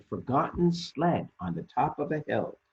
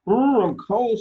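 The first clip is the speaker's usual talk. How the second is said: much higher and louder shivery cry; Lumpy cold; 11 semitones higher, 9 dB louder than usual. Acted. Lumpy complaining of the snow, high and loud. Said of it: "says Lumpy".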